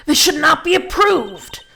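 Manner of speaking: loud, slow indignant objection; indignant shout. Acted. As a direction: protest it loudly and slowly.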